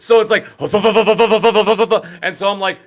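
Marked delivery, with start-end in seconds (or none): 0.5-2.2 fluttering "vuh-vuh-vuh" rotor sound; imitated helicopter noise. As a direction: mouth the chopping roar of rotors. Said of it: "It sounds like an Apache helicopter is landing".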